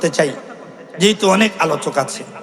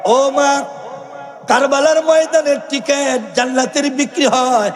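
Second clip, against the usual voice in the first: high, drawn-out melodic wail; son calling to mother; 8 semitones higher, much slower than usual. Acted. Young son begs his mother to explain, high chanted plea. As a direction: cry out to her in a high, stretched chant. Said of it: "ডেকে বলে".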